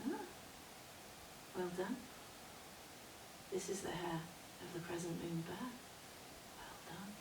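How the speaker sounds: soft, unhurried approval; calm wise woman. Acted. Old woman looking at the bear's hair, calm. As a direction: murmur it with quiet composure. Crone calmly examines the hair.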